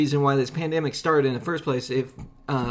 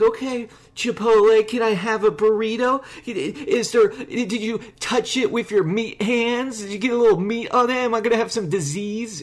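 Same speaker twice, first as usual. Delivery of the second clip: much higher, sarcastic and condescending; snide mocking questions; 9 semitones higher, 4 dB louder than usual. Retelling how he mocked the Chipotle worker, snide and high. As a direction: needle the worker with fake-polite questions. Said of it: "a complete asshole".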